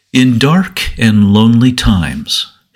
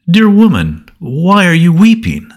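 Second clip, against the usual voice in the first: angels' question; 7 semitones higher than usual. A narrator's reading. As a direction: lift it high like a heavenly messenger. Angels question the weeping Mary.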